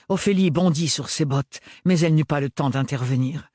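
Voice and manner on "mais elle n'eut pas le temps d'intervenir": ordinary delivery, nothing marked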